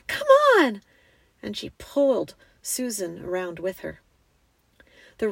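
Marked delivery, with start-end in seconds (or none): 0.1-0.8 high, loud urging; urgent girl. A narrator's reading. Lucy pulling her sister round, urgent cry. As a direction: call out insistently, pitched high. Lucy urges Susan to turn.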